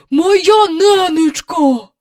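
гучно й дуже повільно, приголомшено; протягни з подивом. A narrator's reading. Приголомшений доглядач. (доглядач ошелешено вигукує)

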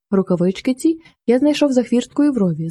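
usual speaking voice

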